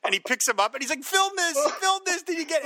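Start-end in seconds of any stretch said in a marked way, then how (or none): 1.0-2.6 high, pressing order to film; urgent command. Retold or imitated. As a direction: demand the camera keep rolling.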